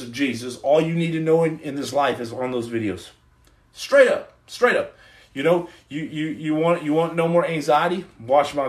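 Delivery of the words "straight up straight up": high, loud burst of certainty; hyped affirmation; emphatic straight up. Real, not acted. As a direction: punch it out with conviction. Emphatic exclamation.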